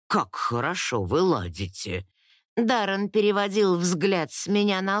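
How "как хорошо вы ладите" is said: low-pitched man's voice; low, wry lordly remark; lord's voice. A narrator's reading. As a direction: play the lord, pitched well down. Narrator voices the male lord.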